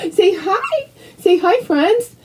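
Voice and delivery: high, coaxing pet voice. Real, not acted. Urging her dog to say hi, high baby talk. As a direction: coax the dog to greet. Prompting dog to greet viewers.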